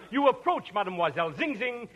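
ordinary delivery

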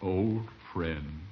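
low, hushed, sentimental; tender farewell. Acted. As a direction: address a dear friend at the end. Mock-solemn farewell between stranded rivals.

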